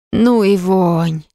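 breathed out slowly, holding breath. A narrator's reading. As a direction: exhale it, trying not to breathe. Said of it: "выдохнула, стараясь не дышать".

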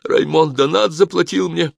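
high, loud, anxious insistence; frightened babbling. A narrator's reading. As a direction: blurt out the confession. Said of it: "пролепетал он".

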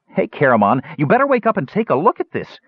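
very high, urgent little voice; eager kender. Acted. Tas rousing sleeping Caramon, high and urgent. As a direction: pipe up high, pressing to rouse him.

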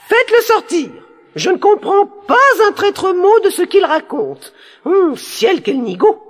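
very high, exasperated princess; crying out. A narrator's reading. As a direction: order the guards out in a high-pitched cry. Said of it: "leur cria". (princesse qui crie, exaspérée, très aigu)

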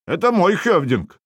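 high, indignant protest; возмущение. A narrator's reading. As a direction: insist hotly, pitch jumping up. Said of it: "возмутился Хакстейн Хакспьёт". (возмущённо настаивать)

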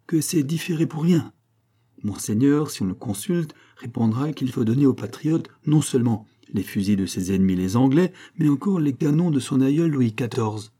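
ordinary delivery

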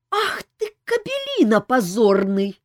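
high, loud slow furious cry; enraged. A narrator's reading. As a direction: explode at him in fury. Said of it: "разъярилась Ольга".

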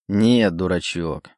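plain reading voice

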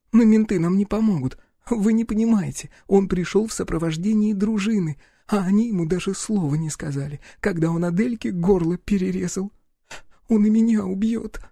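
put-on high-pitched, desperate girl's voice; frightened young woman. Girl warns that police won't help, frightened. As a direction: insist in a high, scared voice.

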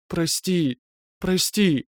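high whimpering plea through tears; sobbing apology. Acted. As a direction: beg forgiveness, voice breaking. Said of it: "Стонущий зов перебивали жалобные, поскуливающие нотки".

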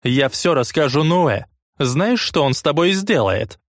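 put-on high-pitched angry girl. Angry girl threatens narrator. (разгневанная девчонка)

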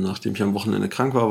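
ordinary talk

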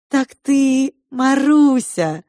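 high, loud, drawn-out vampire taunt; toothy sneer. A narrator's reading. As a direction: stretch it out slowly, mocking, high and loud. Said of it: "усмехнулся клыками Матиас".

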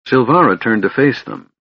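ordinary delivery

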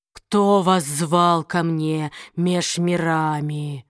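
low, slow, cracking like broken glass; a slow rasp like shattering glass; demon voice. Acted. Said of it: "Голос демона напоминал треск разбитого стекла".

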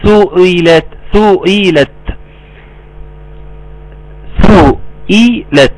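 slow, measured tajweed chant; Quran recitation. Shown as a demonstration. Teacher reciting verse after verse for students, slow recitation. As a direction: hold the long vowels and nasal noon.

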